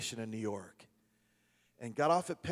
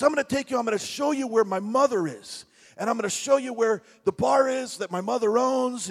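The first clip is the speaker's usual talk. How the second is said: put-on Brooklyn accent; 8 semitones higher, 7 dB louder than usual. Retold Brooklyn guy.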